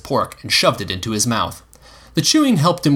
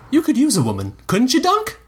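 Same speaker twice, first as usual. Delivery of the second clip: lightly voiced teasing boy; cheeky kid teasing; 13 semitones higher than usual. Egg teasing Dunk.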